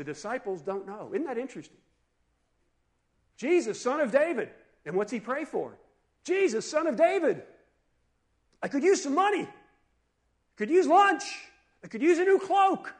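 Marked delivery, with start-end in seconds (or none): 3.4-4.5 acted cry for attention, high and loud; raised, imploring shout; yelling. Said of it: "As soon as he starts yelling that". 6.2-12.9 loud, high mock petition; hammed-up calling out; yelling. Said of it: "As soon as he starts yelling that".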